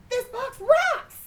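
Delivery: high, loud and slow, thrilled; excited exclaim. Acted. Cat Pete marvels at his box, excited shout. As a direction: shout it like the best discovery ever.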